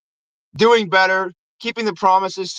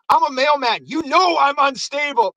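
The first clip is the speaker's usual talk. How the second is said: higher-pitched worked-up warning; mock unhinged; 6 semitones higher than usual. Mailman warning the cops off, mock unhinged. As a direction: imitate a cornered man bluffing madness.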